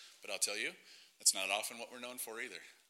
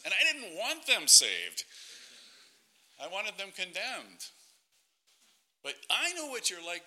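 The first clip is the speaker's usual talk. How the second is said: Jonah; 4 semitones higher, 5 dB louder than usual. Acted. Reluctant prophet telling God why he refused Nineveh, indignant protest. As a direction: complain to God, loudly stressed and pitched up. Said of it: "And one of them is Jonah".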